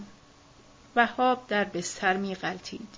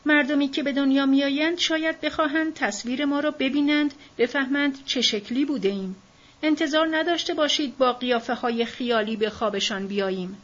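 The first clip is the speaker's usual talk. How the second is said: earnest pleading; 6 semitones higher, 4 dB louder than usual. Acted. Old man urging everyone into photo.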